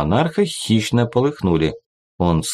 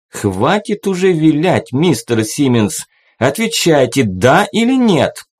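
higher, louder impatient demand; angry demand; 6 semitones higher, 3 dB louder than usual. A narrator's reading. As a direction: snap out the ultimatum. Monarch demands an answer.

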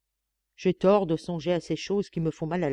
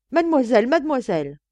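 loud, higher calls to drown her out; shouting over her; 6 semitones higher, 7 dB louder than usual. Acted. Nun trying to drown out the maid's voice, loud and high. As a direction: raise your voice over hers. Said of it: "elle essaya de couvrir ma voix de sa voix".

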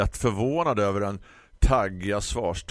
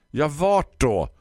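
high, loud irritated snap; hissing florist; 8 semitones higher, 7 dB louder, slower than usual. Acted. Annoyed florist snaps back at the customer. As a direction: spit the question out. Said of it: "fräste Tisten".

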